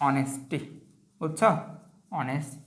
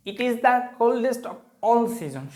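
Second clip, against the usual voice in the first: higher, very slow reading of the sentence; slow dictation; 6 semitones higher, much slower than usual. Shown as a demonstration. Teacher dictating the answer sentence, slow and higher. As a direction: drag every word out for the class.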